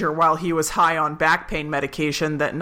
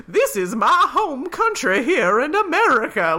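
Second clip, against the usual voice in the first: a mock Southern American accent, laughing through it; Southern drawl; 6 semitones higher than usual. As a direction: put on a hammy Southern accent, breaking into laughs. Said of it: "the brother was like".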